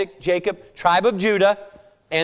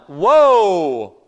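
startled whoa; 7 dB louder than usual. Real, not acted. Reacting to Jesus' claim.

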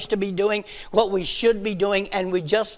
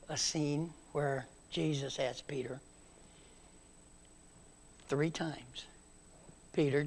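much softer, hushed storytelling; hushed tender retelling; 2 semitones lower, 7 dB quieter than usual. Acted. Preacher retelling Jesus and Peter, soft and hushed. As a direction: draw them in close and gentle.

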